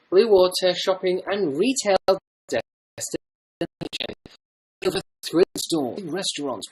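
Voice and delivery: mock promo voiceover with swooping pitch; promotional ad read. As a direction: read it like a shopping centre commercial. Reads out shopping centre blurb.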